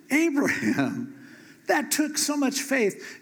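high, drawn-out awed "Abraham"; high marvelling praise. Pretend. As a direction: gush over his faith. Congregation praising Abraham's faith.

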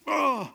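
high, acted grunt of outrage; disgusted groan. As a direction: groan in exasperated royal disgust. King disgusted with Haman.

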